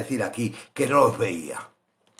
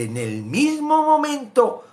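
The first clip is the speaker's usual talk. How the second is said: quoting scripture; 12 semitones higher, 3 dB louder, slower than usual. Acted. Reading God's word dramatically.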